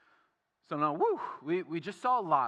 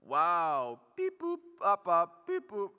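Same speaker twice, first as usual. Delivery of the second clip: mock robot saying wow flatly; 4 dB louder, slower than usual. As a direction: play a robot with no feelings. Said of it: "Like, I am a robot".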